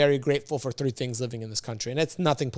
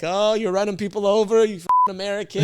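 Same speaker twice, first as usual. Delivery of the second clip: higher, louder imitation of ribbing; mock teasing; 7 semitones higher, 5 dB louder than usual. Shop guys making fun of him after the accident, retold teasing. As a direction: tease him like one of the guys. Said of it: "all the guys were making fun of me".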